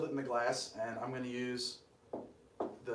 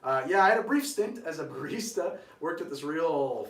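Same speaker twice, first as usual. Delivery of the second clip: brighter, louder retake for the camera; punchy host delivery to camera; upbeat camera retake; 4 semitones higher, 8 dB louder than usual. Shown as a demonstration. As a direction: give it more energy, straight to the lens. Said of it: "we want me to run to that camera".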